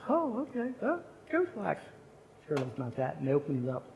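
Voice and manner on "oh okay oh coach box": much higher, airy mock surprise; surprised girls. Staff girls spotting the Coach box, high and mock-surprised. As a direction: play the girls' stunned delight.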